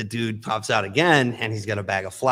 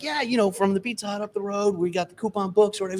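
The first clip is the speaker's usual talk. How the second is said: higher eager pitch voice; eager salesman; 11 semitones higher, 3 dB quieter than usual. Retold or imitated. Salesman answers the guy in an eager high voice. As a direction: sell it brightly and high.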